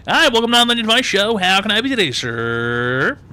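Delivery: high, loud mock-cheery welcome; hammed-up host greeting. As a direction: belt out a silly show greeting. Hammed-up greeting to next caller.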